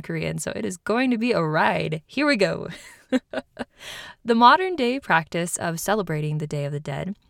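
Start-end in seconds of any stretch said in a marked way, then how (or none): 3.1-4.2 breathy little laugh; quick nervous giggle; short laugh. Real, not acted. Laughs at own accent worry.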